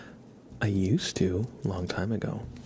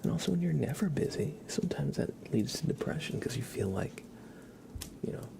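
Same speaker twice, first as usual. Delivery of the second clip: ASMR whisper; 4 semitones higher than usual. Acted. Streamer whispering reflections to viewers, ASMR whisper. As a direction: breathe the words, no voice.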